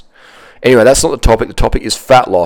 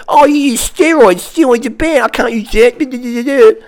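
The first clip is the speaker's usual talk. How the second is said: much higher, slow dopey noob voice; mocking naive noob; 12 semitones higher, slower than usual. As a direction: ham up a clueless beginner. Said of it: "all the noobs out there go".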